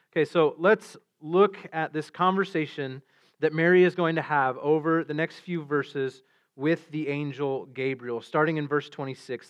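ordinary talk

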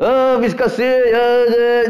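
eager volunteer. Retold or imitated. Volunteer answering brightly.

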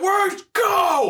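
very high shouted call; silly shout. Pretend. Kicking in the door in a onesie, high shout. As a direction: yell it in a squeaky put-on voice.

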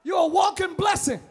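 loud, rousing call to the church; full-force preaching. Acted. Preacher to congregation calling them blessed, rousing. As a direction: lift them up and shout it.

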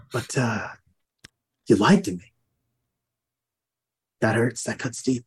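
low, halting menace; wounded threat, kept low with long pauses; threatening accent. Acted. Interrogator confronts Gronk over a lie, low threatening accent. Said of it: "this is my threatening accent".

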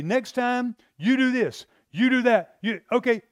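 preacher voicing an exasperated boss scolding his careless disciples; scolding rebuke. Real, not acted.

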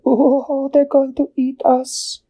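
very high, frightened penguin squeak; squeaky scared penguin. A narrator's reading. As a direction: squeak it out in panic like a tiny penguin.

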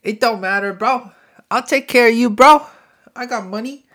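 high, building loud bro voice; big-hearted rich friend showing off. Retold or imitated. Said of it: "And then he goes".

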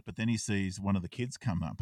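usual speaking voice

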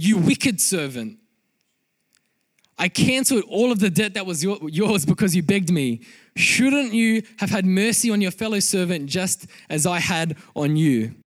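loud, stern rebuke read aloud; angry master. A narrator's reading. Master rebuking the servant who begged him, angry. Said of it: "In his anger".

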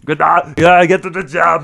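high, loud mock Austrian shout; urgent hammed-up Austrian action hero; Arnold impression. Podcaster joining in the parody, loud Austrian accent. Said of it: "how bad his accent is".

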